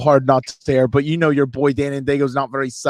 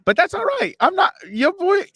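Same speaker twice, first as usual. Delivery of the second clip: high cartoon falsetto; Mickey Mouse; 4 dB louder than usual. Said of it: "I sound like Mickey Mouse".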